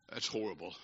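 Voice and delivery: very low, barely audible; grave near-silence. Real, not acted. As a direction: let it sink to a low, grave murmur. Grave verdict after the joke.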